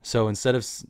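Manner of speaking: ordinary delivery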